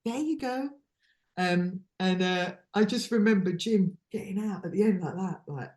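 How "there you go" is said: high-pitched caricature, feeding someone; put-on feeding voice. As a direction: play her in a silly high voice. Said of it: "She doesn't even talk like that".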